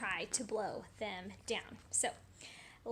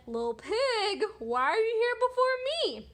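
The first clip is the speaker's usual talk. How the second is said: wolf character; 10 semitones higher, 10 dB louder than usual. Acted. Wolf finding the pig in the tree, high accusing call. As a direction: call up, rising high on the question. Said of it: "So when the wolf said".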